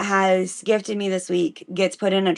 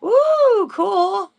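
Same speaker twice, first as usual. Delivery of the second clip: excited; 12 semitones higher, 4 dB louder than usual. Real, not acted. Hears friend's good news.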